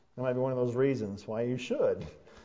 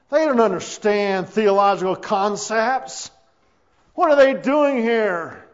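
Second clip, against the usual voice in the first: loud, slow, acted-out protest; indignant; 7 semitones higher, 9 dB louder than usual. Preacher mimicking temple objectors to noisy children, loud indignation. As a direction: object loudly, stretching the words in outrage. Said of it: "they were indignant with Jesus and said".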